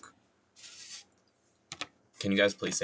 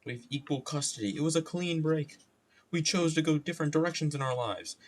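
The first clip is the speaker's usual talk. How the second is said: higher-pitched put-on reassurance; putting on a divorcee; 7 semitones higher, faster than usual. Divorced person describing equal custody, high put-on voice. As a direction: explain it in a raised, put-on voice.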